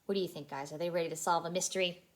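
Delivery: usual speaking voice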